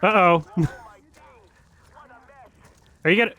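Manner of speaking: normal talking voice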